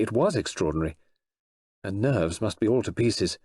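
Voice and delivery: ordinary delivery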